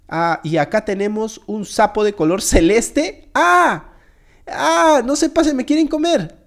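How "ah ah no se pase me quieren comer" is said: high yelps, then a pleading cry; mock panic. Player fears being eaten, in mock panic. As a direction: panic playfully, pitched high. Screams that they will eat him.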